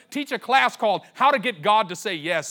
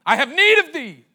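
high, loud ringing cry; the Lord crying out in his spirit; 7 semitones higher, 8 dB louder than usual. Acted. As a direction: cry out the Lord's call.

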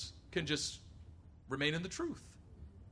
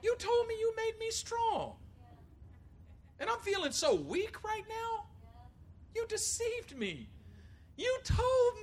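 much higher, slow hurt complaint; whiny lament; 14 semitones higher, slower than usual. Retold or imitated. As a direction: whine at God, feeling betrayed. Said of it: "Jeremiah says".

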